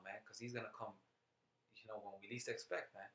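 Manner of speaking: normal talking voice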